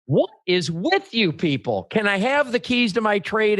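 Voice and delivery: imitated indignant outburst, pitched high; exasperated customer. Customer demanding his keys from the dealership, exasperated.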